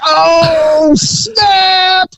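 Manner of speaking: high, shouted mock crowd "oh snap". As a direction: whoop it like a crowd at a roast. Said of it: "they're like".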